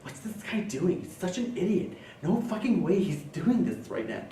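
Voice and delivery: hushed onlookers' murmur; hushed judging. Acted. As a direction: mutter it behind his back. Onlookers judging him under their breath.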